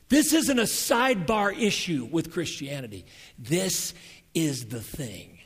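loud, high, fervent preaching; preacher building to a peak; preaching at full force. Acted. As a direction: drive it home to the congregation.

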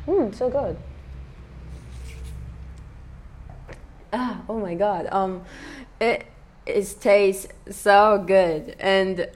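quiet, savoring after a sip; savoring a drink. Real, not acted. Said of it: "just some A S M R time".

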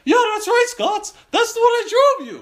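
very high, loud little-kid imitation; loud child. Son pointing out his drawing to dad, high loud kid voice. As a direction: pipe up at the top of your voice like a kid.

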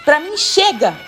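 high-pitched exasperated rant; fed-up outburst. Acted. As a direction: list it off emphatically, at the end of your rope. Exhausted woman gives up, rants. (desabafo exasperado de quem não aguenta mais)

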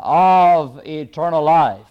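"of eternal life" said slowly with strong stress; deliberate, weighty emphasis on "eternal life"; emphasized words. Real, not acted. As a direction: lean hard on "of", then drawn-out "eternal life". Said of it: "trying to emphasize these words too much".

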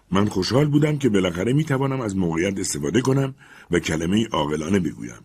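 plain reading voice

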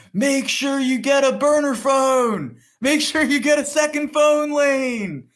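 high, drawn-out mock yell; imitating a heckler's long high holler; hollering from a crowd. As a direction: shout it over a crowd, stretching the last word. Imitating man in crowd yelling advice.

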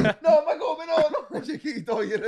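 high, laughing quote; laughing mock protest. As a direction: squeal it out through laughter.

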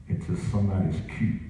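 usual speaking voice

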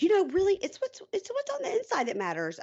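high mocking voice imitating a smitten woman; mock girly voice. Mocking Jessie's excuses about her date, high mock voice.

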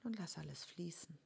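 ruhige, leise Meditationsstimme mit langen Pausen; guided meditation. Acted. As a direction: sanft durch die Meditation führen. Leitet eine Vergebungsmeditation an. (geführte Meditation, leise und ruhig)

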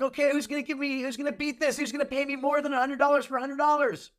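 fast-talking auctioneer calling bids; rising pitch, calling for bids. Acted. Auction of a hundred dollars.

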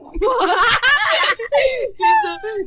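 high, loud shrieking laugh. Acted. Outburst in the grocery quarrel.

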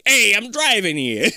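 light hint of Boston accent; a touch of a Boston tough guy. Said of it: "a Boston accent dude".

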